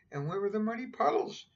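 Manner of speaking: put-on high, childlike Peppa voice; little girl voice. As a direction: pipe it up like a small girl. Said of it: "asked Peppa".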